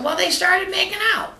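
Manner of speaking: high squawky parrot voice. Acted. Joke teller voicing the parrot to its owner, high and squawky. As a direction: pitch way up like a talking bird.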